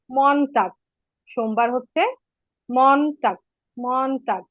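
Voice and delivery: মনটাগ said as German Montag, mohn-tahg; German Monday. Shown as a demonstration. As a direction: model মনটাগ slowly and clearly in German. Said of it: "উচ্চারণ করে দেই".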